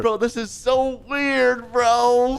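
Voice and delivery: high, drawn-out mock disbelief; incredulous whine. Player squeezed among strangers in bed, incredulous.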